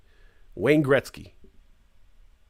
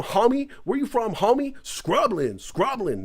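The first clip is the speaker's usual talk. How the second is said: hammed-up street slang, higher pitch; mock gangster voice; 6 semitones higher than usual. Mocking Dublin kids' tough talk. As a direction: strut it like a block homie. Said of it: "we didn't talk like that in Dublin".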